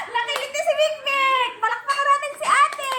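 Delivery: high excited laughing shout. Real, not acted. Excited after tickle game.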